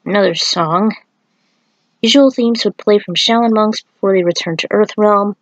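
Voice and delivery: usual speaking voice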